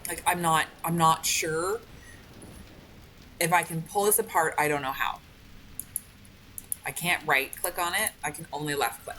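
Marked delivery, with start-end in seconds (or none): none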